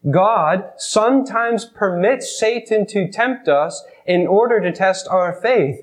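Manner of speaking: slow, each word weighed; slow emphatic repeat. Shown as a demonstration. Preacher repeats the lesson for the congregation, slow and emphatic. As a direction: repeat it slowly so it sinks in.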